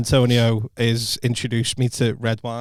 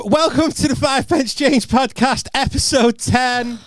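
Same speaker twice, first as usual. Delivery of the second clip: loud, slow show host welcome; podcast intro; 10 semitones higher, 3 dB louder, slower than usual. Acted. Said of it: "was that too loud for you".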